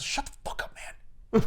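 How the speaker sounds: whispered shut-up aside; hushed telling-off. Retold or imitated. Old Thanos to young Thanos mid-complaint, hushed and whispered. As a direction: cut him off in an urgent whisper.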